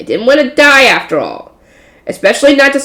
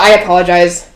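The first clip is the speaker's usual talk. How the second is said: ordinary delivery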